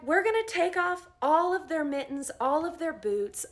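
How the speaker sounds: loud, high call to the team; rally the crew for something bold. Retold or imitated. Nurse rallying colleagues to strip off boots, loud call. Rallying staff, crazy plan.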